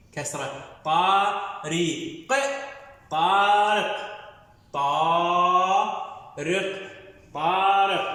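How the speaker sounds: طارق split as طا then رق; syllable drill. Shown as a demonstration. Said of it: "الكلمة الثانية اللي هي". (تهجئة كلمة طارق بصوت عالٍ واضح)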